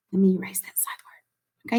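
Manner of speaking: whispered to herself while erasing; whispered aside. Real, not acted. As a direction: whisper the aside under your breath. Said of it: "teacher aside while erasing".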